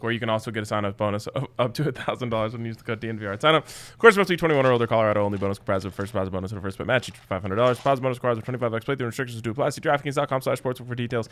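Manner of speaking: speedy, flat disclaimer read; fast legal disclaimer. Acted. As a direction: rattle off the fine print quickly. Rapid sportsbook legal disclaimer.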